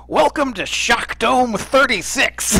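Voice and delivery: high, drawn-out announcer welcome; Pat Summerall. Retold or imitated. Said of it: "Pat Summerall says".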